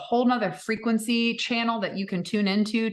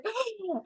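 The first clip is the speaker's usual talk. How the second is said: sharp high gasp; a sudden awed intake of breath; 6 dB quieter than usual. Retold or imitated. Her retold self gasping at the TV, sharp gasp. Reacting to the show.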